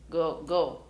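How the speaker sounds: usual speaking voice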